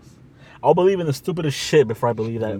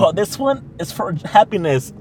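mock sales pitch; 6 semitones higher, 4 dB louder, slower than usual. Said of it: "mocking crystal seller".

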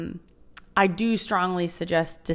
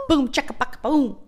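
mock fanfare; 6 semitones higher than usual. Real, not acted. Celebrating the channel news.

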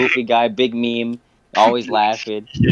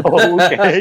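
higher, louder taken-aback okay; awkward okay; 6 semitones higher, 5 dB louder than usual. Retold or imitated. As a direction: caught off guard, play along. Reacting to her insulting boyfriend.

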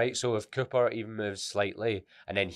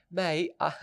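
higher-pitched put-on English accent; mock English accent; 9 semitones higher than usual. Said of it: "Most English accent you've ever heard".

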